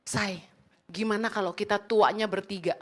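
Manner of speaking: low, soft mock coaxing; sweet-talking husband. Cheating leader sweet-talking his wife into a threesome, low and mock-coaxing. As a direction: imitate a smarmy husband wheedling his wife. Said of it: "rayu istrinya begini".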